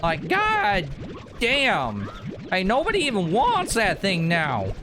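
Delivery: high-pitched, hammed-up ranch hand; put-on rancher. Rancher grumbling over livestock and that unwanted cow, high put-on voice. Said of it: "I don't know why I'm talking like this".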